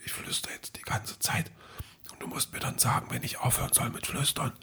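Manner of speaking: unvoiced whisper, very soft. Alexa in whisper mode telling her owner she'll keep whispering. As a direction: breathe the words like a secret. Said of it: "in auch so einen Flüstermodus reingegangen".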